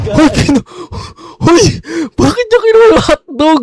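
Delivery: high, loud shocked yell; outraged squeal at the theft; shocked outcry. Real, not acted. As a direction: cry out in disbelief. Shocked outburst at stolen hotdog.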